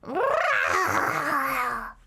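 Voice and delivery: mock big cat roar, lightly growled. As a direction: imitate a big cat's roar. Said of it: "a big cat going".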